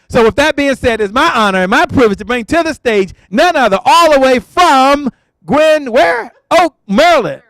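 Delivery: host calling the doctor to the stage, hyped announcer voice; stage announcer intro. Acted. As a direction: bring the guest on like a ring announcer. Host hypes guest onto stage.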